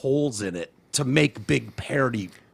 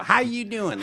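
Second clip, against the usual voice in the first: Chicago guy greeting; 7 semitones higher, slower than usual. Retold or imitated. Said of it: "when you do your Chicago guy voice".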